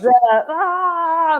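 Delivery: high, loud mock monster scream; Godzilla cry. Co-writer imitating the giant woman's Godzilla moment, mock roar. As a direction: let out a playful Godzilla yell.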